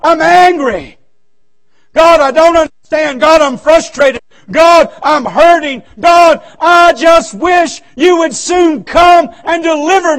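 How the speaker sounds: loud, slow, pleading cry to God; angry prayer. Pretend. Preacher voicing an angry believer, loud pleading prayer. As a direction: pour out anger and hurt to God, loud and slow. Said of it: "you can go to God and say".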